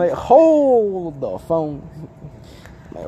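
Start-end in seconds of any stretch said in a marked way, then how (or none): 0.2-1.8 very slow, stretched-out words, raised pitch; drawn-out disbelief. Retold or imitated. Son learning parents knew of the zip ties, drawn-out disbelief. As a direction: stop everything with a long, drawn-out interjection. Retold stunned reply to parents.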